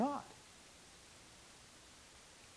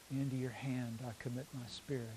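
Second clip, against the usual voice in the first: hushed; 3 semitones lower, 10 dB quieter than usual. Retold or imitated. Quoting Jesus's last words.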